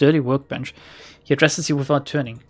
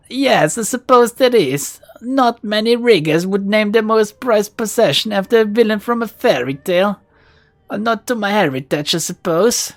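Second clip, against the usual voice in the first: hint of Russian accent; 6 semitones higher than usual. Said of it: "There's a hint of a Russian accent".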